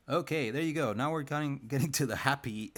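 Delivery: loud, high, bursting with delight; excited shout. Real, not acted. Artist thrilled by own drawing, cheering loudly.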